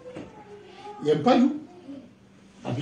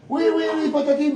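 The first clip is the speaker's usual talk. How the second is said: higher, brushing it off; dismissive; 7 semitones higher than usual. Retold or imitated. Neighbour brushing off the preacher, mock dismissive. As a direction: wave him away, oui oui oui.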